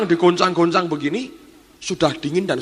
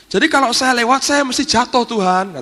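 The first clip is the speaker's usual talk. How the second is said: high, louder pleading protest; imitating worried servant; 10 semitones higher, 5 dB louder than usual. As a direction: plead with the Lord in a raised, anxious pitch.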